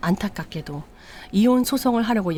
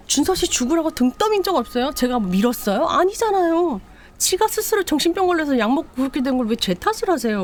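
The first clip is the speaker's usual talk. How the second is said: much higher, defiant protest; shrill indignant denial; 8 semitones higher than usual. Retold or imitated. Daughter-in-law protesting to her mother-in-law, high and defiant. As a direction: throw the blame back shrilly.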